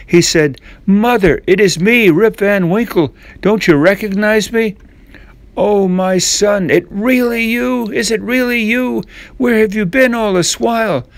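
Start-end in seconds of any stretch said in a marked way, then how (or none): 0.9-4.8 overjoyed. A narrator's reading. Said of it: "Rip Van Winkle was overjoyed". 5.6-11.1 put-on old mother. A narrator's reading. Old mother finds lost son.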